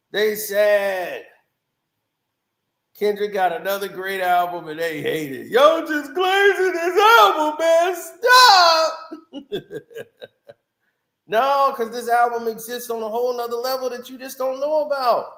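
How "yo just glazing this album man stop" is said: higher, louder mocking fan voice; mock the gushing fans, then cut them off.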